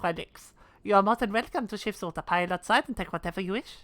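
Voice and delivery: put-on a light French accent. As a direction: lightly play the French innkeeper. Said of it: "with a French accent".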